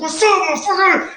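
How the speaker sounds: high, taunting call with mouthpiece in; retold fighting challenge. Mouthpiece in, daring the driver to fight, high taunt. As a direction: throw out the challenge like a fighter squaring up. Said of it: "I was like".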